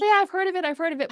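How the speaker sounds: mock viewer, high-pitched and brushing it off; imagined know-it-all viewer. Teacher mimics viewers who claim they know these tools, mock dismissive. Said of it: "So you may be saying".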